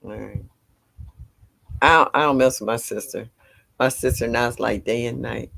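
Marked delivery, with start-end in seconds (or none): none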